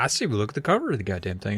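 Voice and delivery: ordinary delivery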